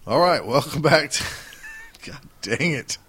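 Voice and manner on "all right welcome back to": ordinary delivery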